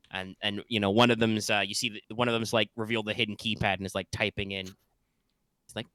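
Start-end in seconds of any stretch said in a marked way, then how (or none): none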